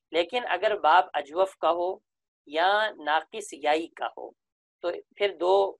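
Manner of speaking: usual speaking voice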